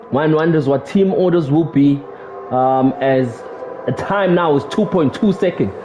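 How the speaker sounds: normal talking voice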